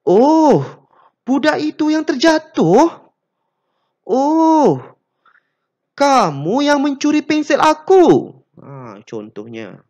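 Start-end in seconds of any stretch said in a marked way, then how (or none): 0.0-2.9 much higher, louder gasp of disbelief; shocked surprise. Shown as a demonstration. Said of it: "tidak menyangka ataupun terkejut". 4.0-8.4 much higher, louder gasp of disbelief; shocked surprise. Shown as a demonstration. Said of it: "tidak menyangka ataupun terkejut".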